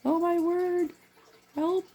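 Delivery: high-pitched mock horror; mock scream. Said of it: "go back screaming".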